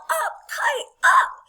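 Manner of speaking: high, loud rallying cry; Frog yelling. Acted. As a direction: punch the final word loudest. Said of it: "and yell".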